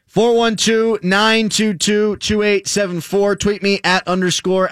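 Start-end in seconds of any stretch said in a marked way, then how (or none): none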